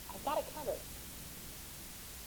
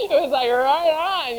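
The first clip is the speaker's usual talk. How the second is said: laughing; 7 semitones higher, 13 dB louder, slower than usual. Real, not acted. Joyful retelling of God's provision.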